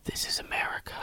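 dry whispered punchline; hushed deadpan whisper. Acted. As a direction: whisper it like a dark joke.

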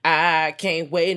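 stretched out, savoring it; slow gleeful drawl. Pretend. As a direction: linger on it slowly. Repeated drawn again.

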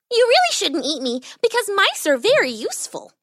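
high little mouse voice; high-pitched small creature; pleading tiny mouse. A narrator's reading. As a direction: argue your case as a tiny mouse.

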